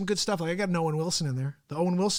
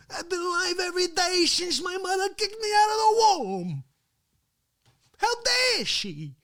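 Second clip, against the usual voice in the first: much higher, shouting, mock-tearful wail; hammed-up outraged crying shout; Facce impression; 4 dB louder than usual. Vlogger imitating his co-host, high mock-crying shout. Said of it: "I just gave you a piece of Facce".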